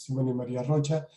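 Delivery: usual speaking voice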